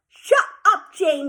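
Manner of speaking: high, loud shouted snap; girl hollering at a rival; hollered. A narrator's reading. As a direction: shout her down. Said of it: "Mouse hollered".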